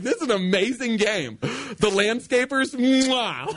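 high, slow marveling; awestruck. Retold or imitated. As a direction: gush over the details. Awed stoned praise.

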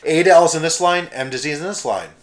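loud announcement, settling at the end; election master correcting himself. Retold or imitated. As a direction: imitate an official redirecting a queue. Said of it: "He's like".

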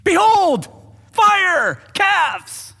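loud, high hammed-up announcement; mock proclamation. Preacher mocking Aaron's story of the calf, grandly proclaiming. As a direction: boom each word like a showman's reveal.

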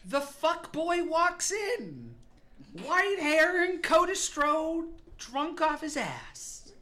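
big, high, loud scene-setting; dramatic announcer. Acted. Game master announcing a drunk man's entrance, dramatic announcer. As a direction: announce a grand entrance at full voice.

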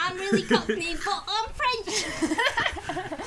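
a laughing, drawn-out Cockney urchin; Gavroche voice. Pretend. Joking as Gavroche to laughing friends, Cockney kid voice. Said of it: "do the Gavroche voice".

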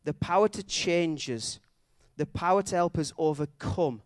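emphatic, building sermon climax in measured phrases; impassioned preaching. Real, not acted. Preacher calling the church to resurrection power, impassioned preaching. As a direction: proclaim each phrase with conviction.

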